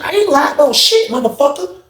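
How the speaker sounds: very high mock-angry denial; acted indignant retort. Officer denying the inmate's accusation, indignant. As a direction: fire back as the indignant officer.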